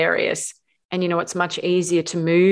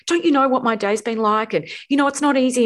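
higher, ranting complaint; self-pitying rant; 7 semitones higher than usual. Retold or imitated. Wife ranting to husband about her day, indignant. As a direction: vent like the day was unbearable. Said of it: "go on this whole rant".

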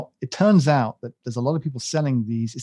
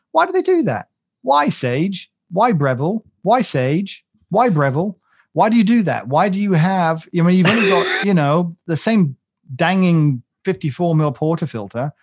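high, loud mock-exasperated questioning; exasperated rant; 5 semitones higher, 6 dB louder than usual. Coffee fan asking why Sage and Breville differ, exasperated. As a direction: plead in comic disbelief, pitched up and loud.

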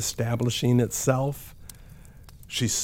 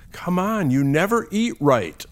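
exasperated mom outburst, higher and louder; raised, fed-up scolding; frustrated mother; 9 semitones higher, 5 dB louder than usual. Retold or imitated. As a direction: imitate a mother finally losing patience. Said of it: "I'm sure, was very frustrated".

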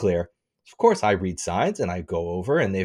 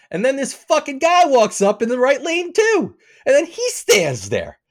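high-pitched, slow incredulous complaint; comically worked-up storytelling; exasperated rant; 4 dB louder than usual. Retold or imitated. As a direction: drag out the outrage, pitched way up. Exasperated telling of second offender.

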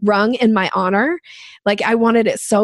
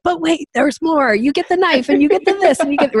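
high, fast hammed-up TV-pitch voice; infomercial pitchman; 7 semitones higher than usual. To the product manager, mock infomercial pitch, high and fast. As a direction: sell it like a late-night infomercial host. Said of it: "the infomercial when it's like".

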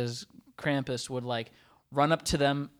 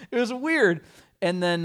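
ordinary delivery

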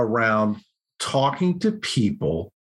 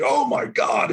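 excited gasp; 12 semitones higher, slower than usual. Retold or imitated. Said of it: "And everybody's like".

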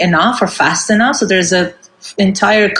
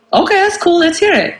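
easygoing approval; 11 semitones higher than usual. Retold or imitated. Audience quoted.

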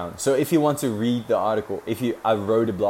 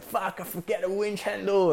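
high, flustered realisation; panicked crew; 9 semitones higher than usual. Retold or imitated. Crewman realising he forgot the winch handle, high panicked voice. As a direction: blurt it out in a panic. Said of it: "and it's like".

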